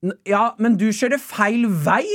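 high, drawn-out protest; slow, indignant correction called from afar; imitated rival driver. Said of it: "hører jeg fra andre enden".

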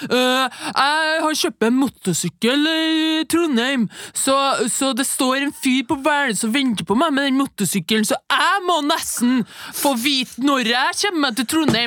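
mock the self-important guy ahead in line; whiny mocking voice for the queue jumper; pushy man in line. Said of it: "piece of shit fyren som sto foran meg".

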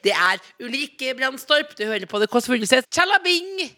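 upbeat announcer voice, some words slow; radio host read. Acted. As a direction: sell it like a radio promo. (programleder-stemme)

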